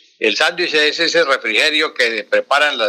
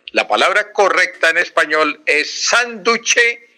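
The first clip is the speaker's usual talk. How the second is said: "sánduche" stressed on the A and pitched higher, slowly; emphatic teaching; 5 semitones higher than usual. Shown as a demonstration. Professor telling listeners to hear it well, emphatic "sánduche". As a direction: make them hear "sánduche" clearly. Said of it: "óigase bien".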